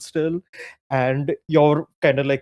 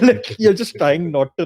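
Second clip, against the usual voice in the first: usual speaking voice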